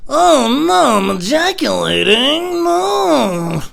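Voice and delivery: high, loud, drawn-out wail; hammed-up cry of dismay; mock wailing. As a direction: wail helplessly, dragging the words out. Helpless mock wail at climax.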